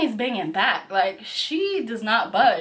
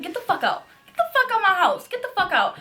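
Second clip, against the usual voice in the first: high angry yell; yelling get out; 8 semitones higher, faster than usual. Retold or imitated. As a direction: order him out. Throwing the man out.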